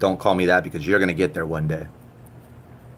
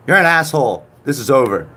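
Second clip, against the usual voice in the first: 1 mock anger; 6 semitones higher, 6 dB louder than usual. Mock anger at chat keyword.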